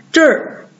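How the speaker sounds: 这儿 as falling "zher"; erhua demo. Shown as a demonstration. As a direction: model 这儿 alone, r-coloured. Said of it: "或者是我们说".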